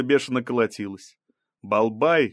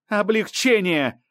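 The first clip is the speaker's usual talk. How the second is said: high, drawn-out accusing cry; heated, high-pitched outburst; almost shouting; 10 semitones higher, 4 dB louder, slower than usual. A narrator's reading. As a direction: hurl the word, nearly yelling. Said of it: "Балбай почти кричала".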